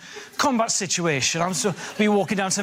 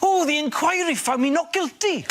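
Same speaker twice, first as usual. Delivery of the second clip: mock excitement; 7 semitones higher than usual. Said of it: "So you're like".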